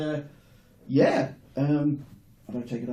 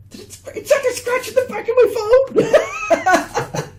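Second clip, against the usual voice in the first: dismayed cry; 5 dB louder, slower than usual. Real, not acted. Finds a scratch on his phone.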